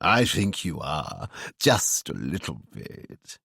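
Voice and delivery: low, slow knowing tease; winking tease. A narrator's reading. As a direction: say it with a wink. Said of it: "Ewan winked".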